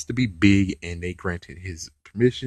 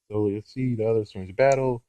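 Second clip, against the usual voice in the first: ordinary delivery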